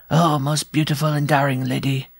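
high, shrill little dwarf; shrill cry. A narrator's reading. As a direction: pipe it like a pin scratching on glass. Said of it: "in a voice as shrill as a pin scratching".

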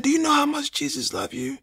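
very high, soft imitation of a nurse; caring nurse, light and high; tender nurse. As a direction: lift the voice high and hushed to comfort. Nurse comforting a patient, retold.

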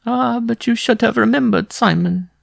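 very high, plaintive woman's voice; tearful cry. A narrator's reading. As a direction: cry it out high, close to a sob. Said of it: "a sob rising in her throat".

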